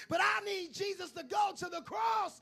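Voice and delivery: shouting sinner pleading for the cross; desperate crowd voice. Acted. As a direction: cry out like a sinner needing a sacrifice. Voicing crowd member wanting crucifixion.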